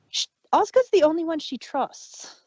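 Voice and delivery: normal talking voice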